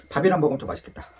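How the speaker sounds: usual speaking voice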